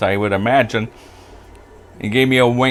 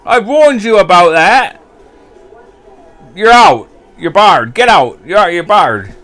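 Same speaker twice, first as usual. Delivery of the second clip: loud high barring shout; landlord yelling; 9 semitones higher, 10 dB louder than usual. Retold or imitated. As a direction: throw the drunk out, bellowing. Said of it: "And the landlord goes".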